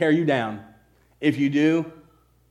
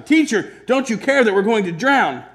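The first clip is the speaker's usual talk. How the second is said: higher, louder panicked cry; disciples shouting; 7 semitones higher, 4 dB louder than usual. A narrator's reading. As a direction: yell it over the storm.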